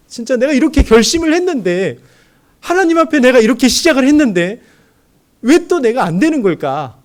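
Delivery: loud, high cry of self-reproach; high and loud, asking why he failed; despairing lament. Retold or imitated. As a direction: wail it like a man failing his vow. His inner lament quoted.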